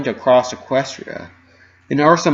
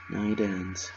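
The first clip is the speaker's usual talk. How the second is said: hushed reply; 2 semitones lower, 10 dB quieter than usual. A narrator's reading. Reassuring Fluttershy quietly.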